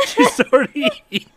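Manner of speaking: high laughing echo; shocked laughter. Real, not acted. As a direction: repeat it through laughs. Echoing through laughter.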